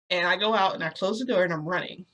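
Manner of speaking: ordinary delivery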